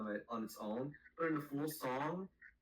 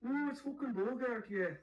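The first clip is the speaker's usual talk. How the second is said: put-on Irish accent; 10 semitones higher than usual. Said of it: "Accent out".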